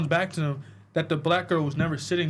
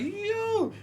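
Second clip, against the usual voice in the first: high, startled yo; shocked yo; 4 dB quieter than usual. Retold or imitated. Retelling the camera moment, high shocked yo. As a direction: yelp it up high. Own shocked reaction retold.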